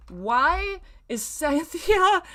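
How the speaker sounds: slow, high, drawn-out disbelief; baffled. Real, not acted. Reader baffled by a character, slow high disbelief. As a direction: stretch it out in disbelief.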